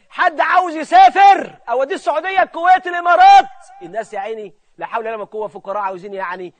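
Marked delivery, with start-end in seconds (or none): 0.1-3.5 high, loud hawker's call; tout's loud pitch. Acted. Swindler touting travel abroad to poor people, loud hawking call. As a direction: call out destinations to draw a crowd.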